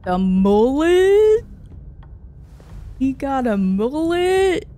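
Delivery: slow, loud disbelief; drawn-out amazed question; surprised. Real, not acted. Surprised at character's haircut.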